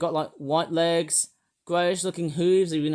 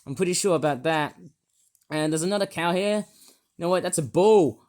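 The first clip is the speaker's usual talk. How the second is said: normal talking voice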